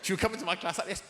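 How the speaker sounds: ordinary talk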